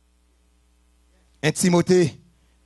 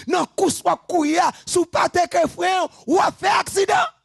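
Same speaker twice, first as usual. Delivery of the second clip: high, loud preaching shout; fiery preaching; 11 semitones higher, 4 dB louder than usual. Acted. Preacher warning the congregation, shouting high. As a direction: hammer the warning home at full force.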